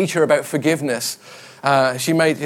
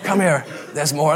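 put-on Irish comedian's catchphrase in an Irish accent; Jimmy Cricket. Preacher recalling an eighties comedian's catchphrase, Irish accent. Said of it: "in an Irish accent, though".

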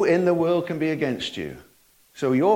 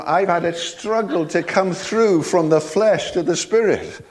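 ordinary talk, nothing marked

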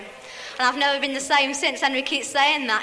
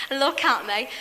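normal talking voice

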